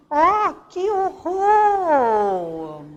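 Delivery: loud, slow, drawn-out cry of horror; horrified exclamation. Shown as a demonstration. Teacher showing an exclamation to the class, loud and horrified. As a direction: gasp it out as if shocked. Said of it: "Ponto de exclamação".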